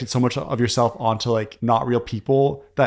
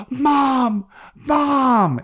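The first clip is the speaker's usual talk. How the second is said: much higher mock cry for mom; retold scream; 12 semitones higher than usual. Owen calling for his mother, high mock cry. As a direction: call out for mother, hammed-up. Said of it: "He screamed for his mother".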